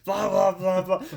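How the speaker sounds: mock the dad ranting to mom; frustrated dad voice. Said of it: "He's like".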